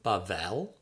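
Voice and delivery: "Bavel" said as bah-VEL; careful Hebrew "Bavel", bah-VEL; Hebrew pronunciation. Shown as a demonstration. Author teaching readers a Hebrew word, careful bah-VEL. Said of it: "that's pronounced Bavel".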